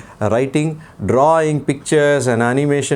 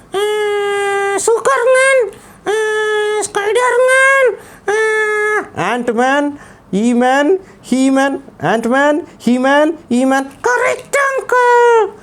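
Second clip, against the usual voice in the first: put-on high, eager child puppet shouting heroes; excited puppet boy; much slower than usual. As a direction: rattle off heroes like a proud kid. Puppet lists superheroes excitedly.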